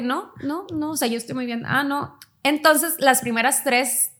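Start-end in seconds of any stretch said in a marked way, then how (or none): 0.0-2.2 mock "I'm fine". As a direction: brush it off as if all is well. Faking fine in therapy.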